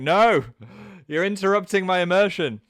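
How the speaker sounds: high, loud wail of dismay; mock protest. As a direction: act out an anguished objection. Acted protest at hearing English speakers.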